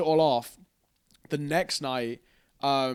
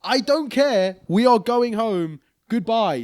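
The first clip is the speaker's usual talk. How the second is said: loud, raised, higher telling off; firm telling off; 7 semitones higher, 6 dB louder, slower than usual. Retold or imitated. Rich telling the following lady to go away, loud and firm. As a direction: shut her down firmly and loudly.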